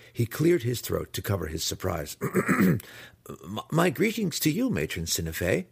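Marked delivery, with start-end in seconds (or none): 2.2-5.7 nervous stammer. A narrator's reading. Startled impostor greets the matron, stammering high. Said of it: "he managed to stammer".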